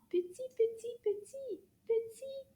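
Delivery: very high, little squeaks of words; high-pitched, playful tininess; tiny voice. Shown as a demonstration. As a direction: make the voice as small as the thing. Illustrating very small.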